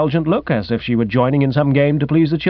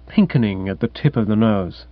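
ordinary delivery

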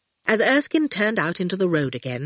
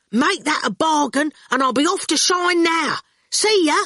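high, lively, raised voice; eager bargainer; 9 semitones higher, 3 dB louder than usual. A narrator's reading. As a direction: seal the deal and dash off. Ginger's character voice.

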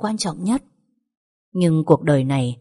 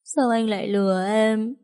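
higher, broken grieving question; weak tearful lament; 7 semitones higher than usual. A narrator's reading. Widow asking her dead lover in a high tearful voice. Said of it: "chỉ có thể phát ra thứ âm thanh yếu ớt".

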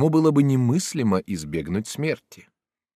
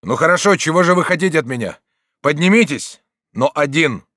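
raised, forceful call; loud speech; 7 semitones higher, 8 dB louder than usual. A narrator's reading. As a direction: call down to someone below. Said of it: "Потом он громко сказал". (громко, повышенным голосом)

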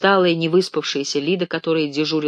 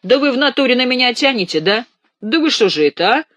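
much higher and louder, hammed-up; indignant shout; 9 semitones higher, 7 dB louder than usual. Ganechka accusing roommates, loud and indignant. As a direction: throw the accusation back at them.

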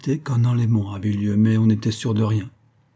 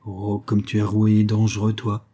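plain reading voice

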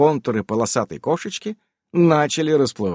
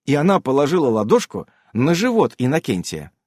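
ordinary delivery

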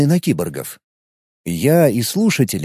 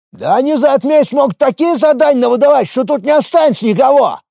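agitated shout; 11 semitones higher than usual. A narrator's reading. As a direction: voice from the crowd, riled up. Голос из группы, возмущённо. (возмущённый выкрик)

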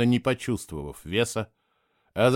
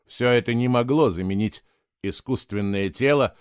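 plain reading voice

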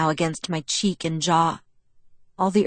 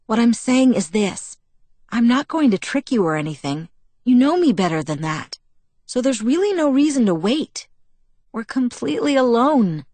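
higher, insistent coaxing; wheedling; 5 semitones higher, 4 dB louder than usual. A narrator's reading. Bella argues there's no reason to wait, wheedling. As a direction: talk them round eagerly, pitch climbing. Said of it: "my voice wheedling".

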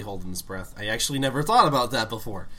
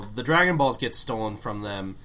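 normal talking voice

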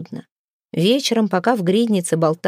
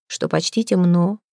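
ordinary delivery, nothing marked

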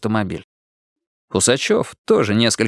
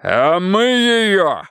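rabble-rousing shout; 8 semitones higher, much slower than usual. A narrator's reading. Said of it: "взмахнул рукой Гаврила".

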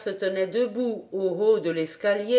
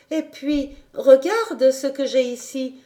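put-on higher, louder proud girl; triumphant; 4 semitones higher, 4 dB louder than usual. A narrator's reading. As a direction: show it off in triumph. (triomphante, voix aiguë et forte)

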